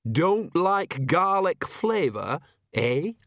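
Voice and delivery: stern and suspicious, ending on a prod; stern challenge. A narrator's reading. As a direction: challenge the guest, drawing it out. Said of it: "said Nanny sternly".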